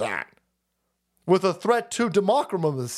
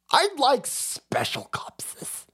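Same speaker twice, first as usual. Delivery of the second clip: mock Paul Pelosi; 5 semitones higher, much slower than usual. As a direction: drag out the request like a dazed old caller. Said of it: "this is Paul Pelosi".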